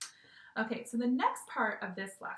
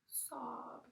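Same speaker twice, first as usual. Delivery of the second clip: mock sob; 3 semitones higher, 10 dB quieter than usual. Shown as a demonstration. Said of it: "Sob means to cry really hard".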